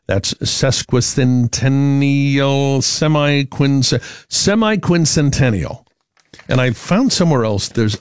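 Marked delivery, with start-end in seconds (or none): 0.3-5.8 slow, halting stab at "semiquincentennial"; slow, careful, higher-pitched struggle with the syllables; sounding it out. Real, not acted. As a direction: work through the long word piece by piece. Sounding out an unfamiliar long word.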